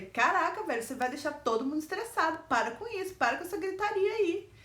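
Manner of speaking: high, loud telling-off; scolding. Retold or imitated. Her retold scolding of the boss for the yelling. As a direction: snap at him to stop yelling.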